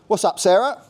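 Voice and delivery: lightly done Cockney with a touch of West Country; a hint of Cockney, West Country creeping in. A narrator's reading. Jake greets Sarah in light Cockney with West Country hint. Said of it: "Jake spoke in a Cockney voice, but with a hint of West Country".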